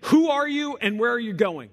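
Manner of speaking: loud, high soldier calling again; gruff mocking soldier. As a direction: bark the challenge at a stranger. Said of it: "the officer calls out again".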